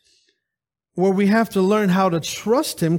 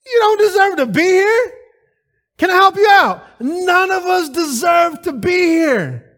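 higher, louder preaching cry; emphatic rebuke; 8 semitones higher, 4 dB louder than usual. Real, not acted. Preacher rebuking proud churchgoers, loud high cry. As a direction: hammer it home.

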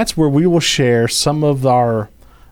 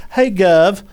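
cheeky cartoon British hail; British accent; 5 semitones higher than usual. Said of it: "How'd you lose your accent?".

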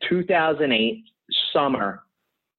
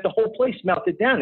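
high, breathless retelling; excited gossip; 9 semitones higher than usual. Retold or imitated. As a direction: spill the news like a panicked colleague. Coworker voiced in story.

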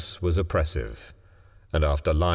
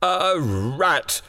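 put-on frightened old man, voice raised high; shaking tones; 9 semitones higher than usual. A narrator's reading. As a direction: stammer it high, trembling with fear. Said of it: "said the old man in shaking tones".

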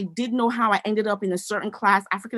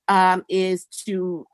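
ordinary talk

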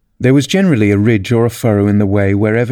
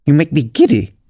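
high, irritated royal complaint; lightly screamed queenly grievance; mock scream; 4 semitones higher than usual. A narrator's reading. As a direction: complain crossly in a raised pitch. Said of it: "screamed the Queen".

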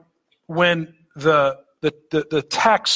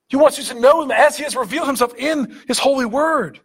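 fervent preaching; 7 semitones higher than usual. Acted. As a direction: drive the point home to the congregation. Preaches with rising force.

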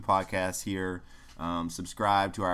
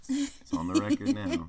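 teasing; 4 dB louder than usual. Acted. Teasing his wife.

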